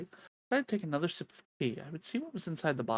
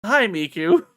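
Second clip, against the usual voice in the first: loud greeting; 3 semitones higher, 13 dB louder, slower than usual. Real, not acted. Greeting again.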